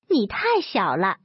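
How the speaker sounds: ordinary delivery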